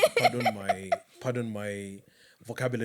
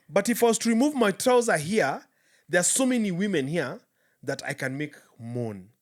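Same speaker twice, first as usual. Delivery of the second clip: higher, louder imitation of a market boast; boasting campaigner; 5 semitones higher, 6 dB louder than usual. Retelling the candidate's speech to market crowds, mock boastful. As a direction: play a campaigner addressing the crowd, louder at first. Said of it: "he kept saying, in vernacular".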